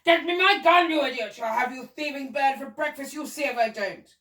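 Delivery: high, loud threat easing slightly; angry shouting. A narrator's reading. Duke threatens the thieving bird, angry shouting. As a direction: bluster and threaten at the top of the voice. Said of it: "the Duke was shouting to the gardener".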